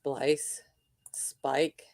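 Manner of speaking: unvoiced whisper of Spike; whispered guess. Real, not acted. Host whispering her guess at the gremlin's name. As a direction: breathe the name like a secret.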